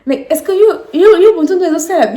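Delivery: put-on high, loud accented mother voice; mother laughing, mocking; imitating mother. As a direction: mimic mom's English-mixed worry. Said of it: "elle me dit".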